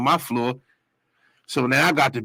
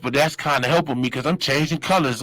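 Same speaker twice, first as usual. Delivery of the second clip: ordinary talk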